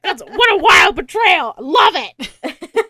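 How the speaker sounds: high, shouted mock delight at being betrayed; gleeful admiration. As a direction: belt it out, hammed-up and thrilled. Retold dying character relishing betrayal.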